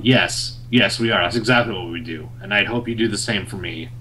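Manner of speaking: low, understated gruff reply; gruff Wolverine. Acted. Wolverine answering Spider-Man's question, low and gruff. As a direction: answer low and curt, lightly in character. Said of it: "Very, very gruff answer".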